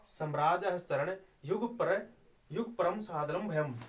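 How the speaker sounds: slow chant with a break after "युग पर"; metrical recitation. Shown as a demonstration. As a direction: recite slowly, pausing at the yati. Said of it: "वाचन होता है". (यति पर रुकते हुए धीमा पाठ)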